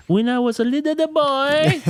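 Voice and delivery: very high, slow Borat impression; a hammed-up Borat imitation; silly high Borat voice, drawn out.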